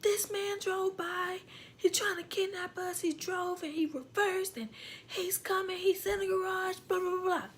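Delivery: high pitched panicky retelling; frantic explaining. Retold or imitated. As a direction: rush to explain the danger, high. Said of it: "And I'm like".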